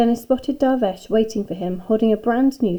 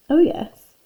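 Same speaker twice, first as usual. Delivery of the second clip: higher, wary reply; trepidation; 6 semitones higher, 4 dB louder than usual. A narrator's reading. Dennis hears his teacher has written a play, apprehensive. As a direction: respond warily, dreading what follows. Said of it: "said Dennis with trepidation".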